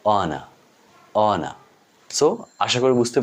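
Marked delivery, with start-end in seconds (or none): 0.0-1.5 অনা with silent h and no final r; careful British-style অনা, r dropped; British pronunciation. Shown as a demonstration. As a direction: say অনা as "o-na", ending open. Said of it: "তারপর উচ্চারণ হচ্ছে".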